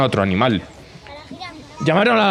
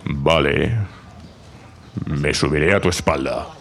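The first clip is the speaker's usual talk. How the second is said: much lower, deep lion voice; 12 semitones lower than usual. Acted. Lion agrees to climb on the zebra, deep and low. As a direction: rumble it like a big lion.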